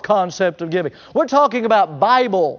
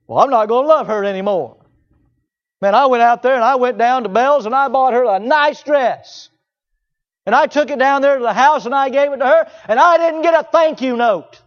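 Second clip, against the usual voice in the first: louder, higher huffy complaint; a touch of a sulking churchgoer; mock resentful giver; 5 semitones higher, 5 dB louder than usual. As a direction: play the offended giver, lightly.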